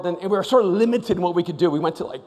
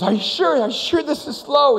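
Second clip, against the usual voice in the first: higher, worried questioning; nervous Delbert; 9 semitones higher than usual. Retold or imitated. Delbert strapped in, asking his friends, higher worried voice.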